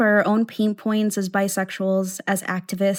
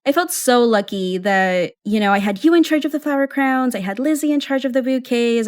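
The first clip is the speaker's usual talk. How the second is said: normal talking voice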